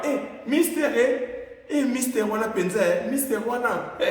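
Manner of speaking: high rough hail across a room; calling out. Shown as a demonstration. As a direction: shout Mister to get his attention. Calling out to a stranger.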